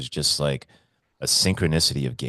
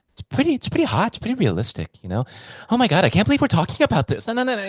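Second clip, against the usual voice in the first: high excited John; 3 dB louder, faster than usual. Retold or imitated. Voicing John describing fantasies.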